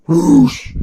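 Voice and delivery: loud breathy whoosh of igniting gas; imitated fire whoosh. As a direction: make the flames go whoosh.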